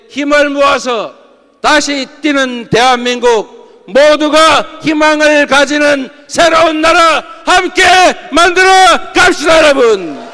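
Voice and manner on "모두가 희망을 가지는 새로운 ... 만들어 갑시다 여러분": rising to a loud rallying cry; rousing shout. Real, not acted. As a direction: build up and shout to the crowd. Speech climax, rallying the members.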